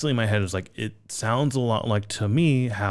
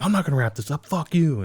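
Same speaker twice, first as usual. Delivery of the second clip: higher-pitched indignant retort; retold outburst, pitched up; mock defiant refusal; 7 semitones higher than usual. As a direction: act out a performer's stubborn comeback. Acts singer refusing stage manager.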